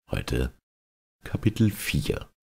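ordinary delivery

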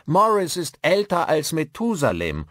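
loud, high teasing announcement; pointedly loud. A narrator's reading. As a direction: say it loud enough for the old man to hear. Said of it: "sagte er so laut, dass auch der Alte ihn hörte".

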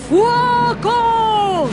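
long, high, loud battle shout; thundered command. Acted. Baron orders his pumps to fire, thundering shout. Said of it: "tuonò il barone".

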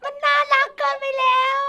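high, loud fangirl squeal; overwhelmed, high-pitched gushing. Real, not acted. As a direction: squeal at something unbearably cute. Gushes at cute message.

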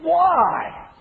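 a pained, exasperated "Why?". Shown as a demonstration. Pastor reading Jesus's question to the crowd, exasperated. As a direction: ask it like you have run out of patience. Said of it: "see if you can see the exasperation there".

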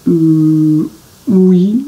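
hesitant, doubtful yes; not very convinced. A narrator's reading. Jean-Claude doubting Billy's plan, unconvinced. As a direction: agree without believing it. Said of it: "pas très convaincu".

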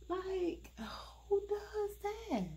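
high, unvoiced whisper of disbelief; incredulous whisper. Real, not acted. Reviewer whispering in disbelief at the wig cap color. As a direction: hush it, can't believe it.

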